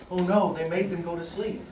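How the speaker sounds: mock dismay. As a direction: deliver a sanitized kids' dub line with mock concern. Toned-down kids' TV line acted out.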